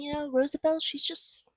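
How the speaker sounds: ordinary talk, nothing marked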